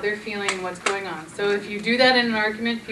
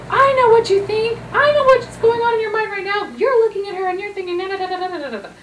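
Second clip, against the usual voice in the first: much higher and louder, accusing; heated accusation; 10 semitones higher, 7 dB louder than usual. Retold or imitated. Partner in a heated argument, loud and accusing. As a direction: fire it off like a blaming spouse. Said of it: "often we'll be in a heated situation".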